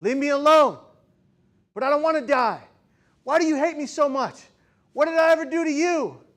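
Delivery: high, loud, desperate shouting through tears; a boy screaming in panic on a crowded bus; retold anguished yelling. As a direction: cry out at the whole bus as if pleading for your life. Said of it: "I began to yell aloud".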